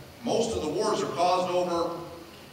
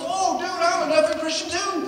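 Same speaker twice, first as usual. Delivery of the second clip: high, loud, laughing dude voice; cheery cussing buddy; 13 semitones higher, 4 dB louder than usual. Retold or imitated. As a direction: toss it off proud and laughing. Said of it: "he goes".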